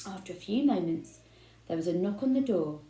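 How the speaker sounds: ordinary delivery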